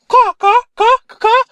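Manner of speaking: loud, very high crow squawks; crow caws. Acted. Crow replying back, cawing. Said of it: "Crow's back like".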